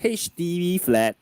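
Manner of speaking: loud announcer voice; public announcement. Pretend. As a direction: call it out like a PA announcement. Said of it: "public announcement".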